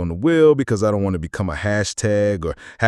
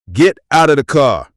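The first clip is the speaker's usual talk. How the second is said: trooper's command; 4 semitones higher, 6 dB louder than usual. Acted. Trooper barking an order.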